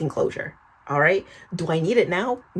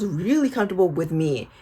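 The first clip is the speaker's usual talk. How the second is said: normal talking voice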